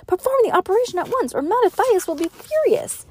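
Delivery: high, fast, urgent order; furious demand. A narrator's reading. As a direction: rush it out in a high, heated voice. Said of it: "demanded with a furious whisper".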